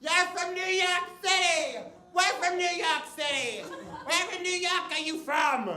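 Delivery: high, squawking party-girl imitation; brash drunk New Yorker. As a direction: squawk it with a thick mock New York accent. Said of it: "very subtly just goes".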